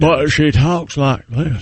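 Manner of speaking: very slow Southern drawl; mock her talk with a drawn-out Southern accent; exaggerated, stretched Southern drawl. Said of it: "I'm using a Southern accent".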